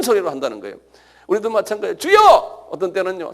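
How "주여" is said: high, loud prayer shout; demonstrated cry of lament. Shown as a demonstration. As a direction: call out to the Lord at full voice. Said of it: "소리 질러가면서요".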